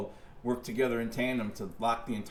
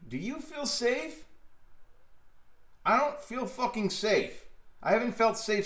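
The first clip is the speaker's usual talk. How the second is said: 1 exasperated retort; 9 semitones higher than usual. Real, not acted. Sarcastic challenge to safety rules.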